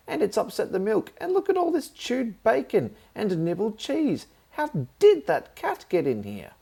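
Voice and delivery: high, loud indignant tirade; furious at the ruined supper; shrill loud scolding; outraged aunt. Acted.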